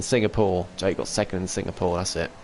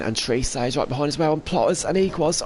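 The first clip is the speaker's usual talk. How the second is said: excited; 7 semitones higher than usual. Real, not acted. Race finish building up.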